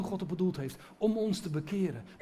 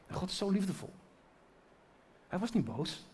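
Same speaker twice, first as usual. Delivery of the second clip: ordinary delivery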